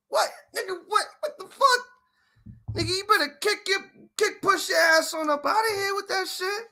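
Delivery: high, loud burst of disbelief; outraged shouting. Real, not acted. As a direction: shout it like you're chasing someone off. Outraged reaction to a diss.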